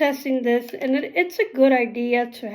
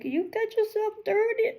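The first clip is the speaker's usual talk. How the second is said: high mock scold; lightly voiced teasing relatives; teasing family voice; 11 semitones higher, 4 dB quieter than usual. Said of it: "my family are gonna be like".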